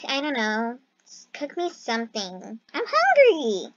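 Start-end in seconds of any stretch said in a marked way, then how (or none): 2.7-3.7 much higher, slow, whining; troll whining for food, high and slow; whiny hungry. Pretend. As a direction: whine like a starving kid.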